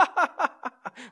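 imitated high maniacal cackle; mock madman's laughing fit; Joker-like laugh. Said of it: "like I was driving with the Joker".

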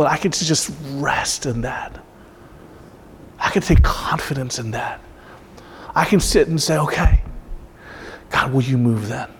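soft, low, slipping toward a whisper; really moved, speak softly as if praying; emotional. Real, not acted. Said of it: "I don't know why I got emotional".

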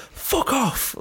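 high-pitched mock scream. Retelling the surfer screaming at the shark, high scream.

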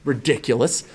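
ordinary talk, nothing marked

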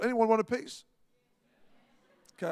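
ordinary talk, nothing marked

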